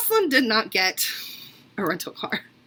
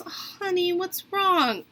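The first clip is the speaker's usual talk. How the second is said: imitated tender concern; 6 semitones higher, much slower than usual. Hotel girl to crying guest, tender concern. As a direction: gently draw out a crying person.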